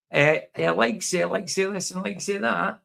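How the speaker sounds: high, questioning Edinburgh sing-song; mock Edinburgh lilt. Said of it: "sound like they're asking a question".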